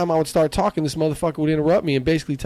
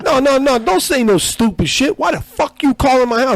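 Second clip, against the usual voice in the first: loud, high-pitched angry caller imitation; irate prank caller; 10 semitones higher, 5 dB louder than usual. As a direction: rant indignantly, pitched well up. Retelling angry prank call voice.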